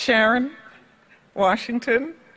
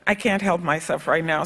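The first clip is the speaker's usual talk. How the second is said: ordinary delivery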